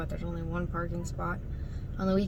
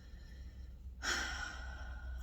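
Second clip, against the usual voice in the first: tired sigh; 12 dB quieter than usual. Real, not acted. Exhausted after bad POTS day.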